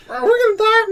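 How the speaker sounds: very high panicky cat voice; freaking-out cats. Retold or imitated. Cats crying in the smoky house, high mock-panicked wail. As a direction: wail it like a terrified little animal.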